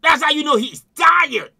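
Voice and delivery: normal talking voice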